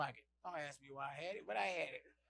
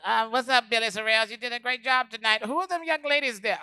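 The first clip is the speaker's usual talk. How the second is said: high, loud, showy Cat Williams voice; Cat Williams impression; 8 semitones higher, 9 dB louder than usual. Comic telling Billy Sorrells he did great, high loud impression.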